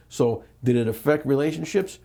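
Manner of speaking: usual speaking voice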